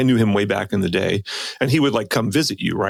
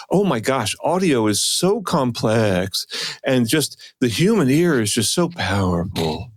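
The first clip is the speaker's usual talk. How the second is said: slow, drawn-out mock wonder; lulling. Mocking an audio presenter to co-host, slow lulling impression. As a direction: stretch the words like a hypnotic, nebbishy lull. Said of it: "a thing of, like, lulling".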